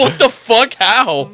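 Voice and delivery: shocked disbelief. Retold or imitated. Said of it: "We're like".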